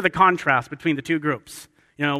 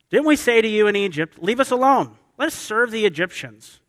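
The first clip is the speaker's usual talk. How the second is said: higher, louder protest of the aggrieved; acted I-told-you-so, pitched up; pessimistic complaint; 6 semitones higher, 4 dB louder than usual. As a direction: complain in an exasperated, raised voice. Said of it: "they get pessimistic and negative".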